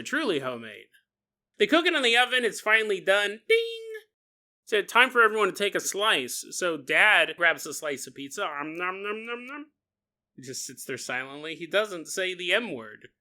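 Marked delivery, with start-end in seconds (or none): 3.5-3.8 high sung ding; oven ding. Retold or imitated. Oven timer going off, high imitated ding. 8.4-9.7 soft munching om nom; munch away quietly; eating noises. Retold or imitated. Dad chewing pizza in silence, soft om nom.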